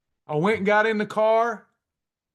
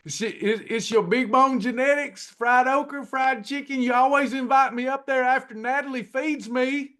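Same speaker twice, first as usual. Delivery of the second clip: mock angry rant; 5 semitones higher than usual. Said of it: "Something like this".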